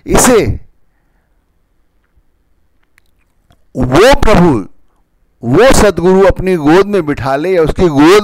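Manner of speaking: loud, high preaching voice; wise mentor. Acted. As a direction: preach it out, building with fervour. Preacher raises voice to exhort.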